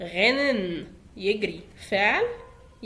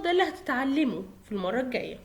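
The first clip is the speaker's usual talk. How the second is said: normal talking voice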